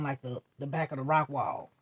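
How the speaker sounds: ordinary delivery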